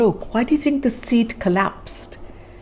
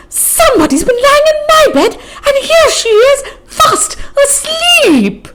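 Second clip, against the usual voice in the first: much higher and louder, near crying; shrill little bear; 7 dB louder than usual. Acted. Wee bear squealing that Goldilocks is in his bed. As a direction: squeal the discovery like a tiny cub. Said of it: "said in a shrill little voice".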